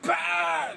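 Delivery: loud high shouted crash burst; sudden shouted bah; explosion noise. Retold or imitated. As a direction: blurt out a car explosion. Imitating cars exploding crash.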